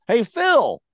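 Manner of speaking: high, loud call across the lobby. Retold or imitated. Desk clerk calls the departing maintenance man, loud call. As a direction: shout his name to stop him leaving. Coworker calling out to maintenance guy.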